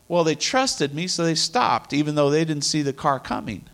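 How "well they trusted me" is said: normal talking voice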